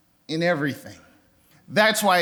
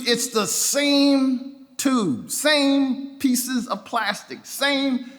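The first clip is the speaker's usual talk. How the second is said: drawn-out, emphatic and higher; slow emphasis; 7 semitones higher, much slower than usual. Real, not acted. Pastor driving home his point, slow and high. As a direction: lean on each phrase slowly.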